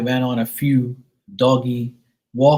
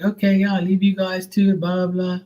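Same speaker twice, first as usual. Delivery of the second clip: lightly voiced dad; 7 semitones higher than usual. Mimicking the dad leaving.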